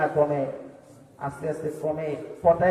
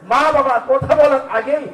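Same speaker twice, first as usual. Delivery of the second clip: loud, high, forceful preaching; fiery rebuke; 7 semitones higher, 7 dB louder than usual. Acted. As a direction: thunder against disrespectful children. Preacher rebukes scolding children.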